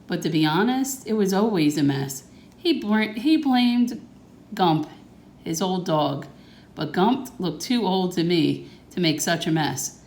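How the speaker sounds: ordinary delivery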